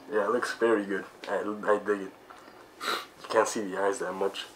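muffled behind full mask; speaking inside rubber mask; through a face mask. Real, not acted. Seen 3: Full-head mask covers the mouth throughout; removed later; R frames only hold it.

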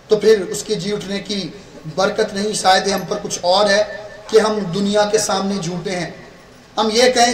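ordinary talk, nothing marked

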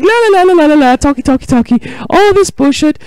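high, loud nonsense chatter; mocking blather. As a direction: rattle it off high and loud like pointless yapping. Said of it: "so I'm gonna be like".

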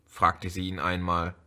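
usual speaking voice

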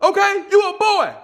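dad yelling at son about passing out; mock furious shout. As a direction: blast it like an outraged dad.

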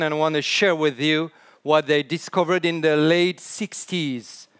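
normal talking voice

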